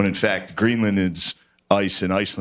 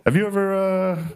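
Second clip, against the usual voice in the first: higher, hesitant question left hanging; mock-awkward feeler, pitched up; tentative asking; 7 semitones higher than usual. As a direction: start to ask, then stall on "uh". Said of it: "they always ask them eventually".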